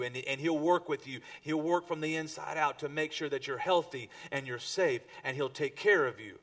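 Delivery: preacher building to the sermon's close; building preaching. Acted. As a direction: drive it home to the congregation.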